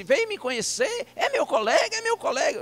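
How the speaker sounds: high, excited old-man imitation; velho pastor surdo. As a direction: play the old pastor, delighted and squeaking high. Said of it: "E ele disse". (imitando o velhinho, voz aguda e animada)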